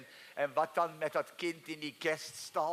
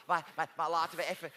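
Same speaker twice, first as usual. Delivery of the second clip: normal talking voice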